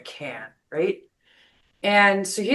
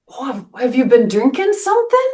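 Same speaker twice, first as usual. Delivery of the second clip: light, quick and higher, not judging; no judgment; 7 semitones higher, faster than usual. Retold or imitated. Old man asking the drunk about drinking, kind and unjudging. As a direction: ask casually with no judgment. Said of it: "You know, no judgment".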